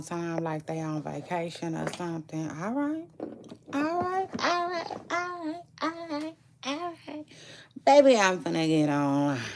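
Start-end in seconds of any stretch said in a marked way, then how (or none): 2.5-7.3 high repeated all right, fading softer; sing-song all rights. Real, not acted. Calling her kids awake with high all rights. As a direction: chant all right high, letting it trail off.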